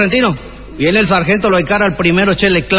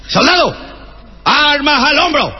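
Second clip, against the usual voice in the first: slow, raised parade-ground order; drill command; 7 semitones higher, slower than usual. Acted. Sergeant to the troops on parade, a slow shouted command. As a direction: call the order to the whole line.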